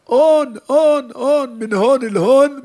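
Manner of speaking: loud, high and slow chant of names; shouting. Retold or imitated. Korach's men shouting at On's tent, loud and drawn out. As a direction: call out for On at the tent door. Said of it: "אז התחילו לצעוק".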